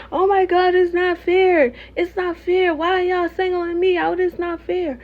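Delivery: high-pitched whiny mimicry of pleading; mocking high-voiced complaint. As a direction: imitate a wronged person begging, high and drawn out. Mocking someone pleading to be accepted.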